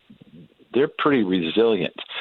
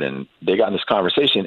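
ordinary talk, nothing marked

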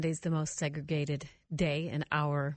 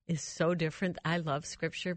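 ordinary delivery

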